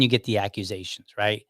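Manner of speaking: ordinary delivery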